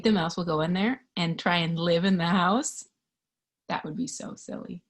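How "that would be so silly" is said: softer whispered aside. Real, not acted. Reader joking to the child, whispered. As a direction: whisper it playfully.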